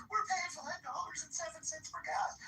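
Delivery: high-pitched mocking voice, whining about gas prices; mocking whiny quote. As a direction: parrot him in a high, sneering whine.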